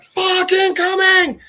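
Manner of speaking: drawn-out, high mock scream; frantic warning. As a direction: stretch it into a panicked yell before the blast. Warns of coming explosion.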